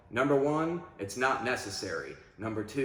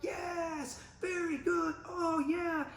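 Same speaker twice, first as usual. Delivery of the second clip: high, bright-eyed praise markers, much higher; animated praise; slower than usual. Shown as a demonstration. Trainer praising his dog, very high excited markers. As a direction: gush praise at a dog doing well. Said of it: "I'm very animated with my markers".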